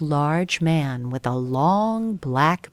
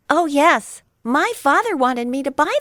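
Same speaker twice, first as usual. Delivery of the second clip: higher, eager little fib; lying puppet boy; 9 semitones higher, 4 dB louder than usual. Acted. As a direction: chirp the lie innocently. Pinocchio lies to shopkeeper.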